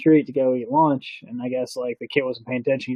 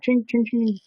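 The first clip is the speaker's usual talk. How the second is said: ching sounds; 8 semitones higher, slower than usual. Retold or imitated. Imitating silverware on ground.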